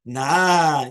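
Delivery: loud, drawn-out "nah"; dismissive nah. Shown as a demonstration. Teacher showing how a no is said, dismissive. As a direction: stretch "nah" out loudly to brush it off. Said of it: "depends on how you say the yes and the no".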